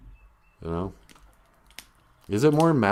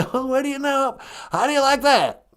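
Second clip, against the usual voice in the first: high, tickled folksy caller voice; George W. Bush; 11 semitones higher than usual. Acted. Caller marvels at his new words, chuckling impression. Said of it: "is this George W. Bush?".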